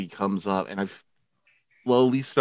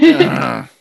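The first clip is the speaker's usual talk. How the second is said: exasperated groan; 2 semitones higher, 8 dB louder than usual. Retold or imitated. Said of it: "And I'm like".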